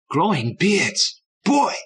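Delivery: high, slow, loud wonder; delighted kid. A narrator's reading. Little brother thrilled by the gold-mine plan, high delighted burst. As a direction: savour it, then burst out high.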